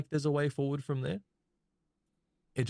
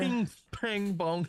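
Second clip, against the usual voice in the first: pitched-up ping pang bong; high clanging sound effects; 8 semitones higher, much slower than usual. Shown as a demonstration.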